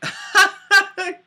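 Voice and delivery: high, loud put-on cackle; audience laughter. Acting out the movie audience's reaction, high mock laughter. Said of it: "audience just being like".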